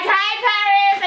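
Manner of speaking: high, drawn-out excited hello; gushing fan greeting. Retold or imitated. Fans coming up to the vlogger in person, high and excited. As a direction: greet her like an overexcited fan. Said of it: "y'all be coming up to me like".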